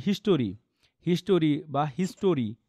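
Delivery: "হিস্টোরি" in three syllables, his-TO-ri; common mispronunciation. Shown as a demonstration. Teacher showing students how many people say it, common mispronunciation. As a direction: say "হিস্টোরি" with a full "to" in the middle. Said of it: "এটাকে অনেকে কিন্তু বলে".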